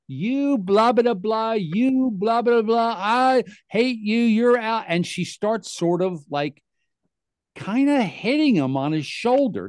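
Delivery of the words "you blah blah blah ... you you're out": high mock scream at normal volume; retold screaming. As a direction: imitate a wife screaming at her husband. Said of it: "like, screaming".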